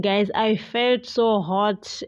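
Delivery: ordinary talk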